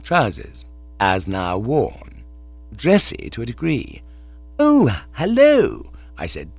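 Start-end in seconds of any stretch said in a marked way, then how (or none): 4.6-5.9 high, slow, airy Bertie voice; a lightly voiced young toff stretching oh; drawling young toff. Bertie greeting the dapper uncle, airy and slow.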